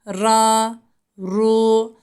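"Ra, ru" said thick and full, from the back of the mouth. Shown as a demonstration. Teacher shows the thick Ra with üstün and ötre. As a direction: darken the R. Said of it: "Harekesi üstün ve ötrü olursa kalın".

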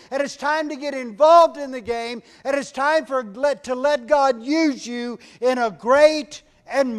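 high, loud rallying sermon; rally the church like a coach at halftime; preaching at full force. Acted. Preacher rallying the congregation, loud and high.

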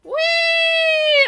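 long, high, loud whee; drawn-out high squeal of glee; gleeful whee. Real, not acted. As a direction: squeal like you're on a ride. Rides along in the game.

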